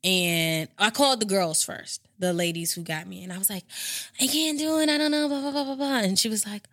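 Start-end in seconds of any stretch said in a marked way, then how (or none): 4.2-6.0 frantic. Retold or imitated. Frantic self-quote.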